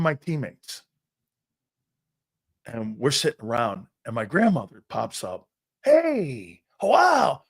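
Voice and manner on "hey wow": imitated grandmother's high, excited welcome; delighted greeting. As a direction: act out an excited grandma greeting surprise guests. Grandmother pops up delighted at guests.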